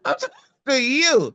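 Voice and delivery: high, fast and pressing; retold insistence, pitched high; insistent. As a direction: push him for a straight answer. Pressing friend in retold talk.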